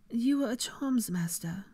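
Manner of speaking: annoyed. A narrator's reading. Hermione to a potions master, annoyed. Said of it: "she said in annoyance".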